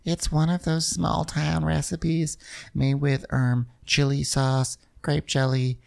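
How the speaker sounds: lightly voiced prim hostess; Catherine impression. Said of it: "So she's like".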